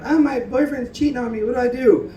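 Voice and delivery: high put-on voice of someone asking for advice; whiny advice-seeker. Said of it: "You know".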